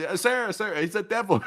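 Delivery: high squeaky mock voice, laughing. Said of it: "He said".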